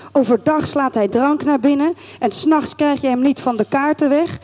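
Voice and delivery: ordinary delivery, nothing marked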